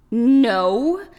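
loud, high outraged scream. A narrator's reading. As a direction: scream it in disbelief. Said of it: "screamed the Drew".

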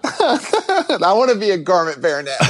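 giggling high through the words; laughing. Real, not acted. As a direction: laugh while saying it. Mock longing, then laughter.

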